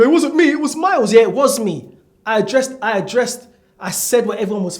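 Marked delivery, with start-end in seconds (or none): 0.0-1.1 higher, louder whiny denial; mock whiny excuse. Steve-O blaming Miles, in a mock whiny higher voice. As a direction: whine it like a kid passing blame. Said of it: "And Steve-O".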